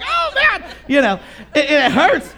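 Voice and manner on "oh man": high yelp, much higher; a kid burned by grease yelping in a high voice; pained yelp. Retold or imitated. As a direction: yelp when the grease hits.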